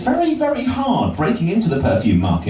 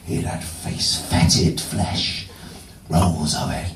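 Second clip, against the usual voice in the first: spooky hush; 12 semitones lower, 4 dB quieter, slower than usual. Pretend. Spooky tale, low and slow.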